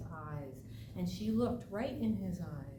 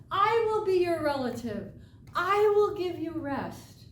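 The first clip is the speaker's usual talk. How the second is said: loud, high, firm call; 9 semitones higher, 9 dB louder than usual. Acted. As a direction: call out over the birds. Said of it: "she called out to them".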